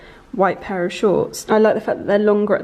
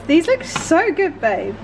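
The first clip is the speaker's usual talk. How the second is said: excited; 8 semitones higher, slower than usual. Real, not acted. Sees the ice cream.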